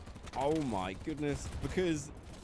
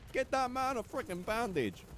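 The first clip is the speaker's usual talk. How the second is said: man down shout; 11 semitones higher than usual. Real, not acted. Yelling at teammates in game.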